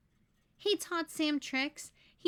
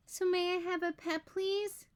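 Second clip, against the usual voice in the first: higher pleading voice, stretched please; a child begging his parents for a pet; 7 semitones higher than usual. Acted. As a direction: beg your parents sweetly. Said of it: "he begged".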